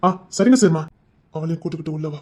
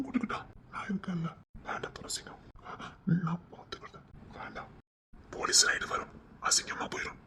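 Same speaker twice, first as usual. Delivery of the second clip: worried hushed whisper; 13 dB quieter than usual. Acted. Worried father whispering urgently against the hotel plan. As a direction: keep it secret, whisper urgently.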